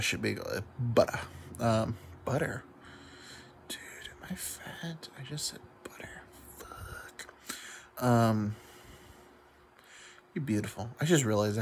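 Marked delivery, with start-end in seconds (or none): 3.7-8.0 soft, slow, voiceless whisper to himself; whispered aside. Real, not acted. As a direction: mutter it under your breath, embarrassed. Caught himself saying butter.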